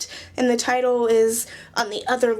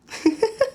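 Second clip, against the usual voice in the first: short, high giggle; 6 semitones higher than usual. Real, not acted. Vlogger laughing off mock complaint to friend, giggle. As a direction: break into a little high giggle.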